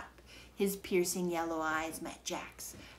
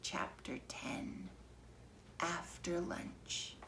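slow whispered heading; 11 dB quieter, slower than usual. A narrator's reading. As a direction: breathe the chapter title softly. Chapter heading read hushed.

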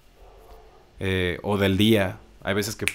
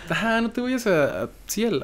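mock high voice; 13 semitones higher than usual. Echoes partner in high voice.

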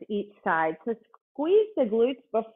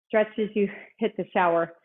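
ordinary talk

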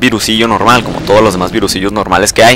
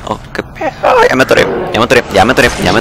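startled; much faster than usual. Real, not acted. Suddenly gets stuck.

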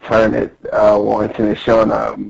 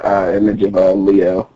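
ordinary delivery

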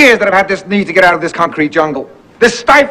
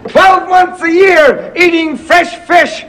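higher, slow, savouring each word; dreamy excitement; 9 semitones higher, slower than usual. Acted. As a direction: sell the dream slowly. Dreamy fish-life pitch to wife.

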